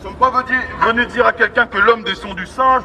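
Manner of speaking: usual speaking voice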